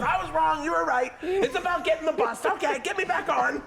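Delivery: loud, very high imitation of friend giving in; mock desperate shouting. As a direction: yell a frantic admission. Friend gives in, begging another turn.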